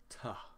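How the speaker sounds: "ta" as an isolated, reduced tuh; first syllable alone. Shown as a demonstration. Teacher isolating the first syllable for students, "ta" said as tuh. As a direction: say "ta" on its own with an uh vowel. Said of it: "The first syllable".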